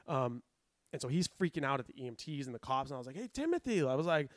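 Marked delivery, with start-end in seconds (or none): 3.2-3.8 higher, sing-out call to a patient; coaxing call, pitched up; calling his attention. Retold or imitated. EMT trainee to freaking-out patient, calling his attention.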